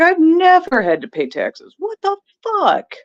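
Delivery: high, slow, incredulous what the fuck; stunned and offended; slowed mock outrage; outraged disbelief. Quoting own outrage at taxes.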